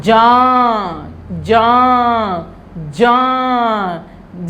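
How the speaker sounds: "जान" drawn out slowly with a nasal vowel; slow nasal jāñ. Shown as a demonstration. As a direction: stretch "जान", mouth open, no tongue n. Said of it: "न की आवाज़ नहीं आती है".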